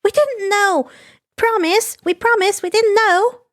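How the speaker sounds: put-on high, bouncy girlish voice; bubbly schoolgirl on the phone; chirpy schoolgirl. Himiko chatting to the hero, high bubbly girl voice.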